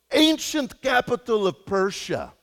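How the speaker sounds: put-on a rough Croatian taxi driver accent, proud of knowing; made-up Croatian accent. Pastor imitates the Croatian taxi driver's accent, naming Persia. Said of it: "I don't know how to do a Croatian accent".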